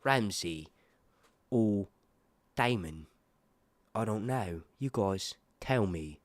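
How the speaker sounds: low, slow put-on British accent; British accent, word by word with pauses. Shown as a demonstration. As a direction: answer in a mock British voice. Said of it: "when I do a British accent".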